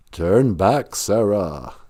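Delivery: low warning from the Goblin King. Retold or imitated. Goblin King warning Sarah to turn back, a low menacing voice.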